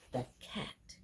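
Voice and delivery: slow whisper, holding the tension; suspenseful whisper. A narrator's reading. Reader building suspense for children about the cat, whispered slowly. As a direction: breathe it out like the cat might pounce.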